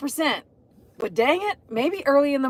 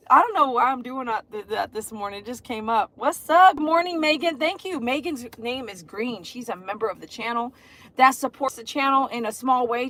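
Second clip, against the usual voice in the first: normal talking voice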